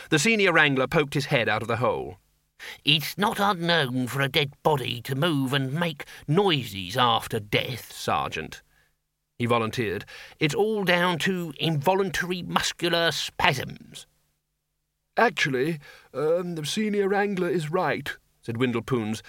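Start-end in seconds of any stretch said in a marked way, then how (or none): 2.8-8.6 slow, drawn-out academic voice; put-on scholar explaining; pedantic senior wizard. Senior wizard to sergeant, pedantic. 10.4-14.1 high, slow academic voice; drawn-out scholarly explanation; pedantic senior wizard. Acted. Senior wizard to sergeant, pedantic. 15.2-18.2 high, slow, hesitant elder; politely interjecting put-on old man; mild old wizard. Undead wizard backing colleague, hesitant.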